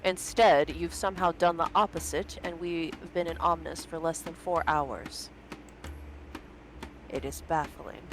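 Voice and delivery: low, cold, scolding general; stern general. Acted. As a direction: lecture her like a stern commander. General voiced in story.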